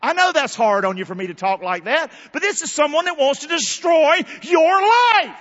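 high, loud preaching shout; preacher shouting the congregation against the devil; fervent shout. Real, not acted. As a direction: rail against Satan at full force.

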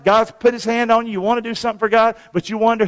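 ordinary talk

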